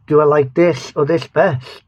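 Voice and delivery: normal talking voice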